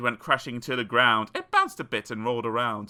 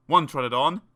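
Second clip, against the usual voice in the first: plain reading voice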